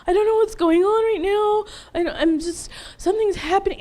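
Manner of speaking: high-pitched retold panic; freaked out. Woman telling her sister something is happening, acted panic. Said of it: "I freaked out".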